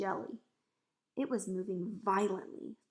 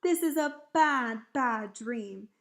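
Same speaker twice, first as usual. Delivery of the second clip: distressed cry; 2 semitones higher, 8 dB louder, slower than usual. A narrator's reading. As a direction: lean hard on each BAD. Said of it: "he cried".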